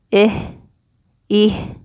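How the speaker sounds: "i" said short and loud, on its own; demonstrated vowel. Shown as a demonstration. Teacher repeating the starting vowel for students, emphatic demonstration. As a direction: punch out each "i" separately. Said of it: "we will pronounce it as e".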